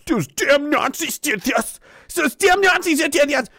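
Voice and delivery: high, distorted caricature voice; hammed-up silly accuser; mocking blame-shifter. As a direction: parrot the accusation in a high put-on voice. Mocking people blaming Nazis.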